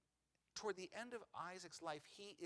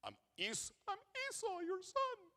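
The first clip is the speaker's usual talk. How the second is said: a much higher, thin faked voice; high put-on voice of a son in disguise; 6 dB louder than usual. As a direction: pitch it way up, pretending to be his brother. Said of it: "because he's got a high voice".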